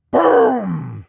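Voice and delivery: drawn-out "boom", falling away; imitated explosion. Storyteller imitating the bathroom door exploding, long boom. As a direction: burst out with a booming blast sound.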